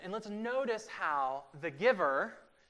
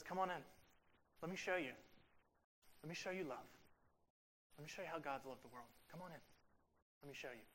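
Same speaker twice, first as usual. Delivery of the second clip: soft invitation; 12 dB quieter, much faster than usual. Acted. Inviting voice of passage.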